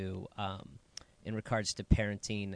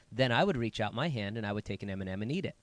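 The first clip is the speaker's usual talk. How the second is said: normal talking voice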